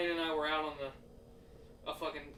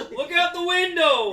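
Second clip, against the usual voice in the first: high, loud hammed-up call; teasing the story by yelling the drunk's line; loud mock drunk; 12 semitones higher, 17 dB louder than usual. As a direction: bellow it like the drunk guy. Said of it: "Hey, look at that window".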